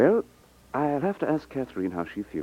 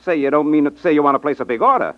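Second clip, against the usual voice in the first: cheerful surprise; 7 semitones higher, 8 dB louder than usual. Acted.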